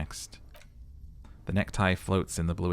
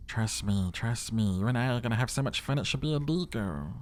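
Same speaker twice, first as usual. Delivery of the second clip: higher put-on voice for a sly, persuasive tie; tempting necktie leaning in close; scheming talking necktie; 4 semitones higher than usual. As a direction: wheedle like a devilish little tie, quick in the middle. Necktie voice held over lines.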